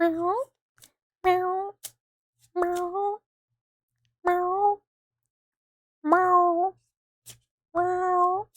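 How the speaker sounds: high, drawn-out meows; repeated long high mews; cat meows. Pretend. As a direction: meow like a cat, holding each one. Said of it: "I'm gonna meow".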